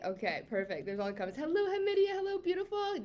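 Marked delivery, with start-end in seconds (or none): 1.4-3.0 high, sing-song hello; excited greeting. Real, not acted. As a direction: greet each name up high, delighted. Greeting viewers by name.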